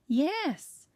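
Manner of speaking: high, falling exasperated outburst; frustrated exclamation. A narrator's reading. Woman on the phone answering Rosa, frustrated. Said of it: "she exclaimed in frustration".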